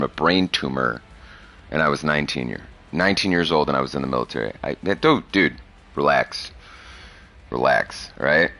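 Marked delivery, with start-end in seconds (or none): none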